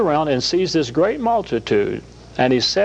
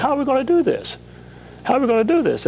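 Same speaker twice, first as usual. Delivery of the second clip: higher, fretting voice of a worried believer; anxious believer; 11 semitones higher, much faster than usual. Retold or imitated. Guest voicing anxious believers facing difficulty, fretting. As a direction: fret over it, higher each time. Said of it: "we say".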